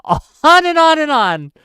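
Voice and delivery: cracking up in disbelief at the endless posts; laughing exasperation. Real, not acted. Streamer to chat about Grok's posts, laughing disbelief.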